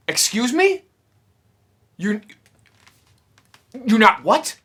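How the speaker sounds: can't believe what he hears; shocked disbelief. Retold or imitated. Fan to the counter lady, high incredulous disbelief.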